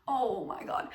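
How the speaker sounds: very soft, drawn-out aside; reenacted stunned murmur; hushed disbelief. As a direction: murmur it low, as if overwhelmed. Retells being laughed at, overwhelmed.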